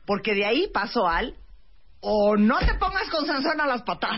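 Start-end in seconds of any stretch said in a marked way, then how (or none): 2.0-4.2 high, emphatic mock threat. Escalating from "no me grites" to la amenaza, threatening. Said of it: "ya la amenaza".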